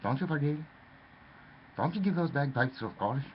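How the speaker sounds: ordinary delivery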